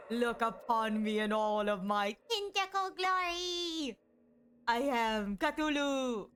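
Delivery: slow grand monster boast; sneering panto villain. Acted. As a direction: proclaim it slowly like a dark god. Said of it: "I am becoming the tentacle monster".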